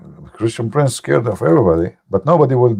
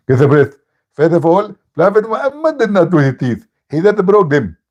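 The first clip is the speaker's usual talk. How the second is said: higher mock preacher voice; imitating Zakir Naik; 6 semitones higher than usual. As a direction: mimic the opponent explaining. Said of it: "Zakir Naik".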